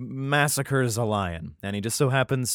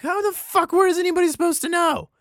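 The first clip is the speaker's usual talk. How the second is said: much higher, louder, fast outraged protest; exasperated rant; 5 dB louder than usual. Real, not acted. Complaining to listeners about the riddle, high fast exasperated rant. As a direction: vent at how unfair it is.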